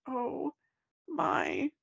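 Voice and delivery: high, wailing mock cry; hammed-up crying voice. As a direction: whimper it like you're overwhelmed. Quoting her overwhelmed reaction.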